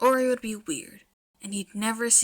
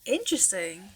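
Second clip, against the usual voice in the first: ordinary delivery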